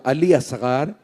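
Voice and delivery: hushed loving father's voice; tender father. Acted. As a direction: whisper it gently like a father to his infant. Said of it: "मौला हुसैन ने फरमाया होगा".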